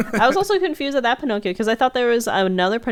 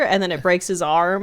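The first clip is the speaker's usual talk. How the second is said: normal talking voice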